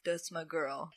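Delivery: low, very soft aside; retold smug aside. Retold aside as he walks away.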